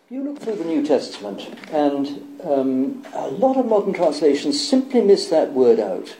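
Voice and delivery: usual speaking voice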